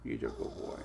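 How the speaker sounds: soft hushed whisper to the dog; whispered praise. Real, not acted. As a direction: breathe it to the dog.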